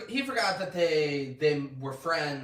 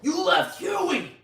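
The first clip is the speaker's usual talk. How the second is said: screaming at her, much higher and louder; furious shout; 12 dB louder, slower than usual. Retold or imitated. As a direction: yell it at her, full rage. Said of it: "And he's screaming at her".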